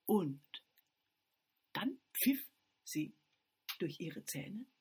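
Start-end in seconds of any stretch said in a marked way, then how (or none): none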